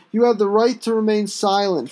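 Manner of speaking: ordinary delivery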